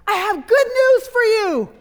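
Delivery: high, loud, jubilant call through a door. Acted. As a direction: holler the good news at great volume. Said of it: "knocking on her door and saying".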